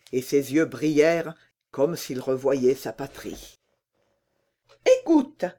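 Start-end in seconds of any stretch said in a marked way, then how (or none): 5.0-5.4 much higher, loud and blunt; bold robber girl. Acted. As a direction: toss it off like a fearless bandit girl. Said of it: "dit la petite fille des voleurs".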